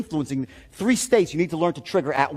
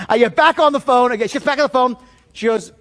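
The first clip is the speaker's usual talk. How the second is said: ordinary delivery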